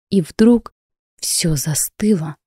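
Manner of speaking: ordinary delivery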